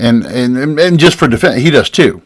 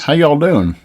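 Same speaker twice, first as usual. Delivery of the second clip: hammed-up Southern drawl on a folksy greeting; mimicking a Southern accent; mock Southern accent; 5 semitones higher, slower than usual. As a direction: greet like a fake Southern politician. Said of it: "sticks on that Southern accent".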